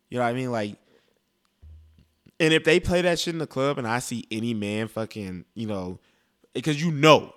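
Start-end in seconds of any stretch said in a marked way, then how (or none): none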